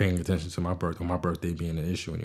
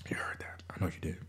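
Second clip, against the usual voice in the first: whisper; 10 dB quieter than usual. Real, not acted. Whispering after drinking on mic.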